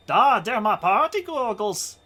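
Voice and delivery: bad German accent. Party guy showing off goggles in a bad German accent. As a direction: ham up a terrible German accent.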